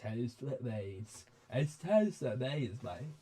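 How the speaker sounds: slow British geezer; old geezer voice. Acted. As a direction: draw it out as a grimy British old man. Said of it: "grimy old man geezer voice".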